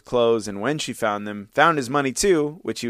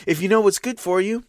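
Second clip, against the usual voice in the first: urgent warning; 8 semitones higher than usual. A narrator's reading. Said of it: "whispered to Andreuccio".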